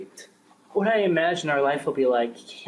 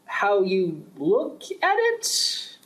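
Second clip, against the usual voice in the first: higher, very slow, "at" rising in disbelief; incredulous echo; 5 semitones higher, much slower than usual. Acted. Official repeating the guard's excuse, slow and disbelieving. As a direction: throw his words back at him, stunned.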